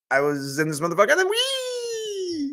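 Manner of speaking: high squealing wee; squeaky video voice. Retold or imitated. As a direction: squeal like the viral squirrel. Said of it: "I remember it was like".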